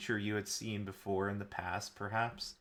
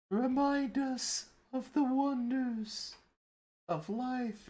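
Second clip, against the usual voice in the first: high, slow, eerie ghost chorus; drawn-out spectral plea; ghostly voice; slower than usual. Acted. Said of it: "in a ghostly voice".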